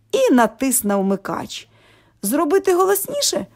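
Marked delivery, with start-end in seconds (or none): 2.2-3.5 calling out. A narrator's reading. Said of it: "гукнув Митько".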